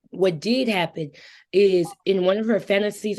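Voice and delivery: usual speaking voice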